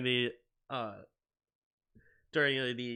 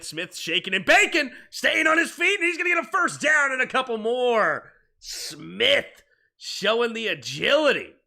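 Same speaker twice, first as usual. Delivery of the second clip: loud, high, thrilled sports call; excited play-by-play; 10 semitones higher, 12 dB louder than usual. Acted. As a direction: shout the big play like a broadcaster.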